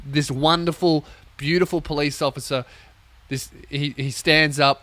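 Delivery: normal talking voice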